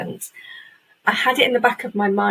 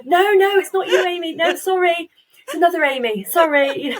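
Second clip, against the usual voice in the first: high, bright mock retraction, much higher; a high hammed-up "sorry, wrong Amy"; imagined apologetic announcer; 9 semitones higher than usual. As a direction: play the awards host taking it back. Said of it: "I wait for".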